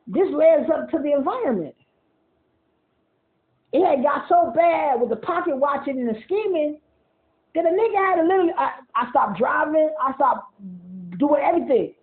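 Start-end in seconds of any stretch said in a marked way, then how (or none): none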